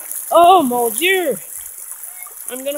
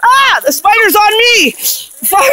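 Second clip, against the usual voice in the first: real panic, loud and high; freaking out about a spider, shouting; panicked; 5 semitones higher, 4 dB louder than usual. Real, not acted. Walked into a spider web.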